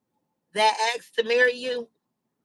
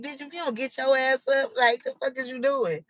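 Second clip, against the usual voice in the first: sassy refusal; 4 semitones higher than usual. Retold or imitated.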